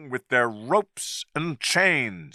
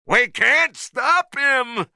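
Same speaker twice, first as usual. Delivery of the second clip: much higher, slow panicked shout; 12 semitones higher, 3 dB louder than usual. A narrator's reading. Sailor shouting that the ape is too strong, high and loud. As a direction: yell it in alarm. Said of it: "shouted one of the sailors".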